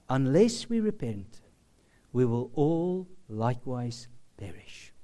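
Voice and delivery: low, soft and grave; hushed solemn quote; sobering. Retold or imitated. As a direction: let the warning sink in quietly. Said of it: "this sobering, universal truth".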